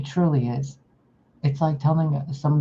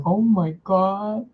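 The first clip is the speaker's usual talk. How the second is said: mock awe; 5 semitones higher, slower than usual. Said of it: "you guys were like".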